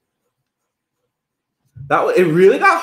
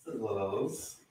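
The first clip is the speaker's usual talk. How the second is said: hushed sing-song; 5 semitones lower, 19 dB quieter than usual. Real, not acted. Bacon sizzling in pan.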